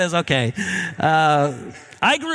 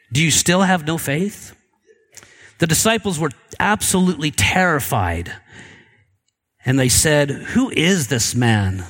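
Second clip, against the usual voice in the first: scripture reading; 4 semitones lower than usual. A narrator's reading. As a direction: read solemnly with long pauses between phrases. Reads scripture aloud to congregation.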